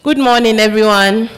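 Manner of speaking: loud greeting to the whole room; calling out. Real, not acted. Host calls good morning to the forum, loud. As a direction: call it out to wake the room up.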